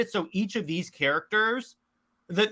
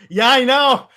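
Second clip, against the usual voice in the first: loud, high, eager agreement; excited agreement; 7 semitones higher, 10 dB louder than usual. Real, not acted. Host agreeing on Iceman actor trivia, excited. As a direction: jump in loudly and eagerly.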